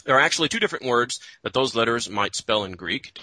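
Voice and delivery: ordinary talk, nothing marked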